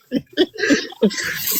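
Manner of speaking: breathy laugh; quiet chuckle; airy unvoiced laugh; soft laugh. Real, not acted. Cohost laughs at question.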